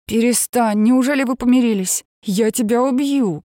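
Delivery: high and loud, playful fury; excited mock threat. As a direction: shout in teasing outrage. Sister's mock-furious reaction.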